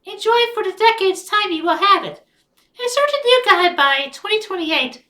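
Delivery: high put-on character voice.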